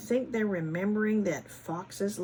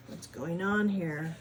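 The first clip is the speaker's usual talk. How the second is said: usual speaking voice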